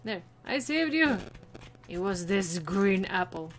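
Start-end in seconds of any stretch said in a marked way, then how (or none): none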